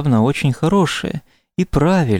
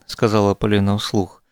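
usual speaking voice

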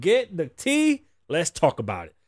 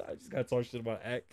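quiet aside; 8 semitones lower, 15 dB quieter, faster than usual. Real, not acted. Laughing aside after a rant.

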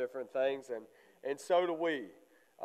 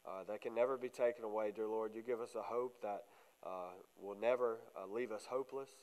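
soft, low-key spoken prayer; 3 semitones lower, 6 dB quieter than usual. Real, not acted. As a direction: pray quietly and earnestly. Leads a prayer, softer.

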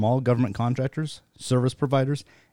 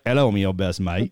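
mock English accent; 4 semitones lower than usual. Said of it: "English is more like".

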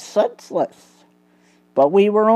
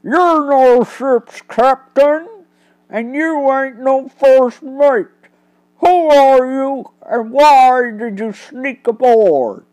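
menacing crook; 6 semitones higher, 4 dB louder than usual. Acted. Crook demanding who the captives are, loud and menacing. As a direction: bark questions at the prisoners like a dockside crook. Thug interrogates the captured detectives.

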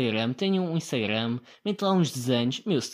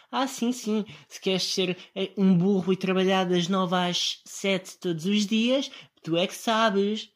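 sarcastic mockery; 6 semitones higher, 3 dB louder, slower than usual. Quoting the girl's sarcastic reply.

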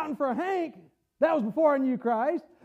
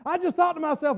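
ordinary delivery

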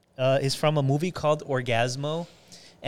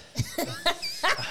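high-pitched giggle; 13 semitones higher, 5 dB quieter than usual. Real, not acted. High laugh.

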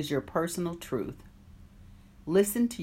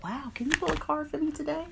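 high, softer mock-eager friend asking; 9 semitones higher, 4 dB quieter, faster than usual. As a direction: imitate a friend begging for a card. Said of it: "And they're like".

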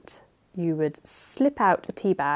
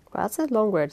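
ordinary delivery, nothing marked

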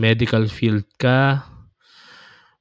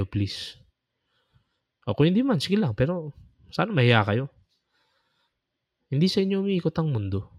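scolding rebuke; 7 semitones higher than usual. Real, not acted. Scolding priests and nuns directly.

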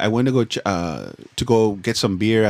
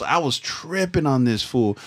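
ordinary delivery

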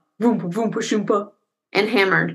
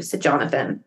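plain reading voice

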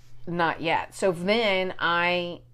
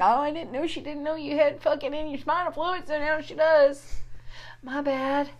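high, sheepish mock apology; lightly hammed-up sheepish voice; sheepish confession; 9 semitones higher, 3 dB quieter than usual. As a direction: own the blunder with a cringe. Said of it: "I'm like".

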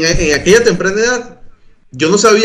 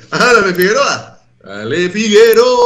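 higher, drawn-out mock call; slow, raised cheering-on voice; imitating hearty greeting; 6 semitones higher, slower than usual. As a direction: stretch the name out in a hammed-up hail. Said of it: "Y ella te decía".

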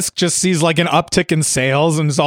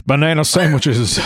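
[name] impression; 3 semitones lower than usual. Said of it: "Big fat [name]".

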